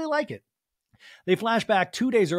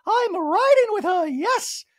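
much higher and louder, slowed put-on; high hammy drawl; mock Dorit voice; 7 dB louder, slower than usual. Host mimicking the catty exchange, high and loud.

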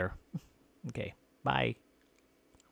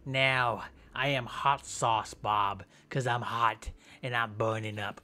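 usual speaking voice

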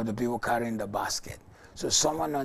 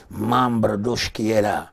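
speaking in tongues; 2 semitones lower, 7 dB louder than usual. Real, not acted. Praying in tongues.

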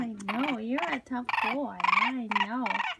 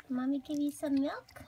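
ordinary talk

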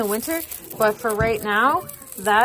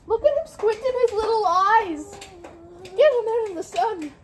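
gushing; 9 semitones higher than usual. Real, not acted. Fussing over grandkids in sun.

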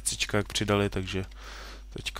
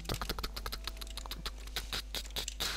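whispered tak; 13 dB quieter than usual. Real, not acted. Player whispering to himself while searching, hushed. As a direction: whisper it under your breath. Quiet muttered tak repetitions.

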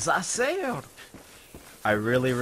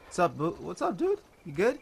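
high dog-talk voice; 4 semitones higher than usual. Real, not acted. Talking to dog.

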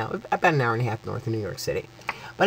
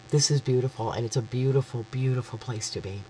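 softer, fading, choked up; getting emotional; 2 semitones lower, 6 dB quieter than usual. Real, not acted. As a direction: voice sinking as she's moved. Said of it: "I'm getting all emotional".

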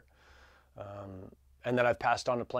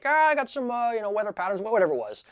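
innocent excuse; 10 semitones higher, 4 dB louder than usual. Retold or imitated. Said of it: "He's like".

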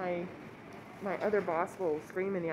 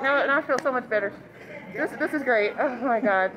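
excited; 4 semitones higher, 9 dB louder than usual. Real, not acted. Starstruck after meeting celebrity.

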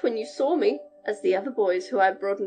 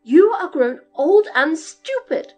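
slow, loud, scolding giantess; loud tones; 5 semitones higher, 10 dB louder than usual. Acted. Giantess scolding her husband to hide Jack, loud and slow. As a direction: scold him down to size. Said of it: "cried the lady in her loud tones".

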